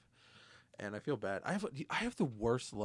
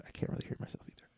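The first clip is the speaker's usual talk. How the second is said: quick whispered aside; faster than usual. Pretend. Cohost whispering that he cannot hear himself. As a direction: whisper it fast under your breath. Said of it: "such remote whispering".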